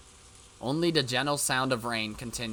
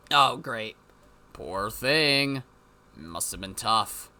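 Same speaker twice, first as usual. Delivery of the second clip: ordinary talk, nothing marked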